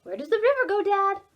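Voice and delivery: put-on high child voice, asking; a curious little owl pipes up; young Oliver. Oliver asking Dad about the river, high and curious.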